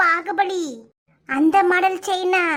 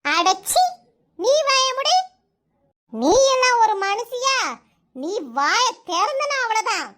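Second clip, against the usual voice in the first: higher, sharp rebuke to shut her up; angry scolding; 3 semitones higher than usual. Acted. Mother-in-law to daughter-in-law, angry scolding.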